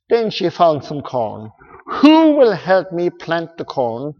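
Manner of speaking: lively storybook read-aloud; reading to children. Acted. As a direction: read it like a bedtime story to kids.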